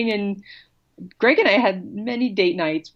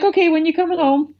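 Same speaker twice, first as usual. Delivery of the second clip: put-on high, casual kid voice, unbothered; breezy independent kid; 10 semitones higher, faster than usual. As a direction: toss it off like a kid who doesn't care. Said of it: "it's like".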